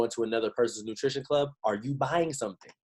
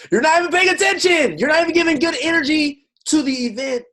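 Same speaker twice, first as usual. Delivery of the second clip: higher and louder, fed-up call-out; exasperated scolding; 8 dB louder than usual. Real, not acted. Coach scolding distracted members, loud and exasperated. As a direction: scold the room for not paying attention. Scolding people who ignore events.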